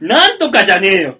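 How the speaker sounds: 大声で突っ込む再現; 呆れた相手に強く言い返す; 怒鳴りツッコミ. Retold or imitated. 無茶振りへのツッコミの再現. (声を張った怒り気味のツッコミ)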